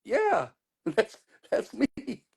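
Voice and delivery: laughing as he owns up; laughing admission. Retold or imitated. [name] confirming who he is to the crowd, through laughter. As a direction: laugh through a proud yes. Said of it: "I go".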